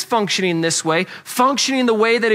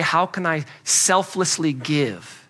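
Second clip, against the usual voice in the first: gentle voice of love; 6 semitones lower, 4 dB quieter than usual. Retold or imitated. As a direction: let love speak quietly and selflessly. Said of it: "But love would say".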